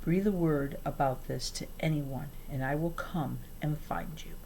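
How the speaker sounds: soft, low whispered threat; man threatening the girls. A narrator's reading. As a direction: hiss it close to their ears. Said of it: "whispered into our ears".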